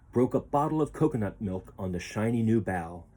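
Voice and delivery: plain reading voice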